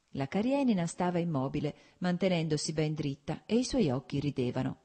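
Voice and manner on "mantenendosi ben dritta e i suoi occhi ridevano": plain reading voice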